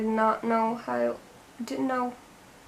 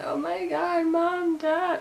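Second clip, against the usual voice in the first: emotional call out; 6 semitones higher, slower than usual. Retold or imitated. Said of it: "I was just like".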